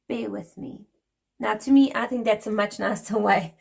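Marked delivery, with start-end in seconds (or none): 0.1-0.9 quiet, hushed, polite "Bear with me"; polite soft "Bear with me". Retold or imitated. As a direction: murmur it gently like a courteous Brit. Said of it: "the people on the other end of the phone go".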